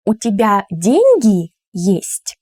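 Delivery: emphatic, slowed question peaking on деньги; stress on деньги. Shown as a demonstration. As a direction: lean hard and slowly on деньги. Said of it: "with my voice".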